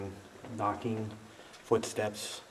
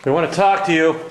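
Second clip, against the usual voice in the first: loud high call to the spirit; 8 semitones higher, 15 dB louder than usual. Real, not acted. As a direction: call out so the ghost can hear. Calling to spirit.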